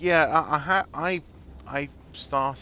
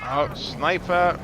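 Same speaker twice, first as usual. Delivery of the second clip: startled slow cry, higher, louder; panicking gamer; 9 semitones higher, 7 dB louder, much slower than usual. Real, not acted. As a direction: yelp at the sudden sniper. Sudden sniper attack in game.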